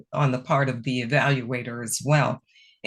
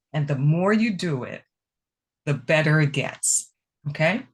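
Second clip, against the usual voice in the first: normal talking voice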